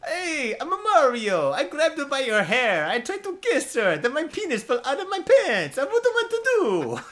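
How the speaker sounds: high, loud, hammed-up Italian accent; Mario Italian accent. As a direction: play Mario in an over-the-top Italian accent. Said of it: "let's do Italian".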